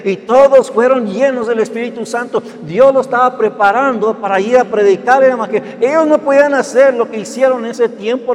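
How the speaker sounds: rising, loud preaching at full force; fiery preaching. Acted. Pastor preaching to the church, loud and rising. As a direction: preach it out to the congregation with fire.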